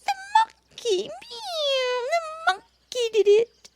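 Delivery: high crying kitten wails; kitten sobbing. Acted. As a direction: whimper for mummy. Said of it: "Baby Bush Cat sobbed".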